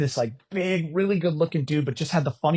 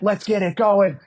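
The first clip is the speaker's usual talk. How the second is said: louder, higher hype call; hyping the crowd; 6 semitones higher, 5 dB louder, slower than usual. Retold or imitated. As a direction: fire up the studio audience. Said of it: "And he's like".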